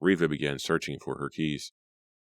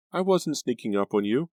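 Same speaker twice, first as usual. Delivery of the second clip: put-on higher, anxious girl's voice; scared girl; 8 semitones higher than usual. A narrator's reading. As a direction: protest in a high anxious voice. Grace's voice.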